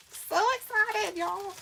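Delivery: high, slow, drawn-out squeal of joy; squealed excitement. Acted. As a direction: squeal it like you can't contain yourself. Repeated catchphrase on livestream.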